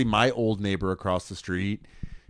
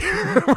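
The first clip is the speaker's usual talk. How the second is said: laugh; 5 dB louder than usual. Real, not acted. Laughing at the story.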